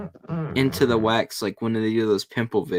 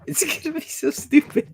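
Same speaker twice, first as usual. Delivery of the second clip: much higher, put-on squeaky voice; squeaky silly voice; 14 semitones higher than usual. Repeating herself to her friend in a much higher squeaky voice. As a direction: squeak it like a cartoon.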